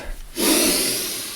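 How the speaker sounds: unvoiced sigh for air; breathy sigh. Real, not acted. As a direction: sigh out to catch your breath. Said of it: "I need to catch my breath".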